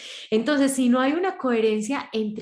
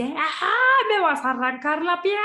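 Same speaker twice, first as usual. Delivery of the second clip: a higher, strange-voiced cry of protest; mock yelp; 8 semitones higher, 4 dB louder than usual. A partner reacting to a Gatúbela scratch with a high mock cry. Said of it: "grita y dice".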